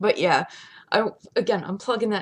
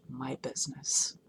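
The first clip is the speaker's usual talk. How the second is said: whispered insistence; 6 semitones lower, 10 dB quieter than usual. Real, not acted. Privacy insisted upon, whispering.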